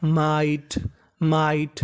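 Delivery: "माइट" said low and careful, twice; teacher reading "माइट" aloud; model pronunciation. Shown as a demonstration. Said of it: "M I G S T".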